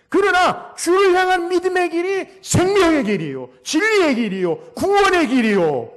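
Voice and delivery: loud, high proclamation; ringing, emphatic sermon climax; full-force preaching. Real, not acted. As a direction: cry out each phrase from the pulpit.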